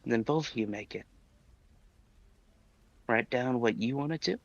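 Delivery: lightly voiced Dizzy character; a hint of the Dizzy character voice. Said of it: "You're still doing the Dizzy voice".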